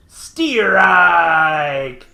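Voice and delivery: loud drawn-out umpire yell; umpire call. Retold or imitated. Umpire's call in retold movie.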